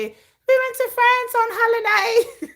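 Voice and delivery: high, slow mock English accent; hammed-up posh English holiday talk. Mocking English people bragging about holidays, in a high English accent. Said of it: "That's my English accent".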